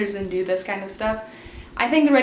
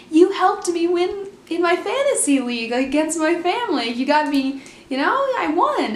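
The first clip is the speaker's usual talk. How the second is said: mock excited gushing; 6 semitones higher than usual. Mom thanks her hockey hero for the fantasy win, gushing. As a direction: imitate a starstruck fan, pitched up.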